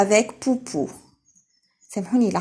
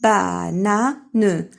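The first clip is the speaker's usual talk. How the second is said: "بانان" drawn out slowly and louder; slow modelled word; 4 dB louder, slower than usual. Shown as a demonstration. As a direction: stretch "بانان" for the class. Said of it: "teacher models word".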